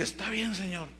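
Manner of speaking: soft, resigned giving in; subdued, reluctant consent; acted surrender. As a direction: yield quietly, voice dropping away. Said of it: "Me rendí".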